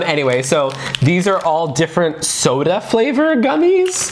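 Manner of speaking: ordinary talk, nothing marked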